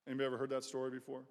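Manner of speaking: ordinary delivery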